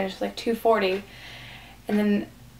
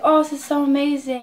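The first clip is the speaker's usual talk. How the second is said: higher, mildly excited imitation; gushing retold praise; 6 semitones higher, 4 dB louder than usual. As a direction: gush lightly as if quoting someone impressed. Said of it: "he's like".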